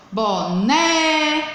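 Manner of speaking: "Boneca" with a long, high NÉ; slow demo of boNEEEca; stressed syllable with force. Shown as a demonstration. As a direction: lean hard and long on the NE of "Boneca". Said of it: "pronunciado com força, ó".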